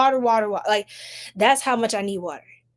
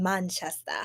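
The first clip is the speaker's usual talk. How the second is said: playful British pronunciation of Manchester; put-on English accent on Manchester; 3 semitones lower, 7 dB quieter than usual. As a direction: say Manchester in a mock British accent, dropping the final r. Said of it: "I love y'all accents".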